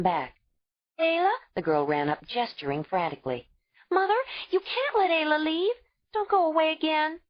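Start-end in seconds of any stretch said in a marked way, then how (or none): 1.0-1.3 put-on much higher child's call; young girl calling. A narrator's reading. 3.9-7.2 put-on much higher child's voice, begging; a scared child pleading; frightened little girl. A narrator's reading. Uba begging.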